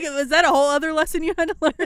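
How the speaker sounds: fast, teasing retelling; mock question. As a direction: rattle off the jab with a laugh.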